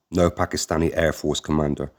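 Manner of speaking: usual speaking voice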